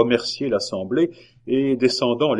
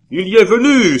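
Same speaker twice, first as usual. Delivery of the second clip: high, loud exclamation; insistent cry; 12 semitones higher, 11 dB louder than usual. A narrator's reading. La Hurière insists to disbelieving crowd, crying out. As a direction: cry it out to override the doubters. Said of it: "s'écria la Hurière".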